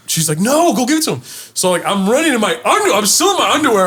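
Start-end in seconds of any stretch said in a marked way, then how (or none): 0.4-1.2 urgent mom. Retold or imitated. Mom urging him.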